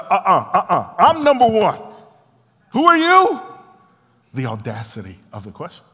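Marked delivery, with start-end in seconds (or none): 0.0-3.4 loud indignant protest climbing very high; Peter, indignant. Acted. Indignant disciple objecting to James and John ruling over him, acted outrage. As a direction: push back with escalating, hammed-up outrage. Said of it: "Peter is indignant 'cause he's like".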